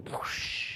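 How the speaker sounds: airy, voiceless whoosh; imitated water gush. Telling how her water broke, imitated whoosh. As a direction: mimic a sudden rush of water.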